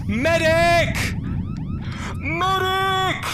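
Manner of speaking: high, loud call for a medic; man down shout. Real, not acted. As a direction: yell for help like a downed soldier. Man down after attack.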